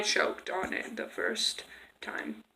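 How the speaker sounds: breathy whisper, slowed. Real, not acted. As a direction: whisper it slowly. Recalls choking, whispered.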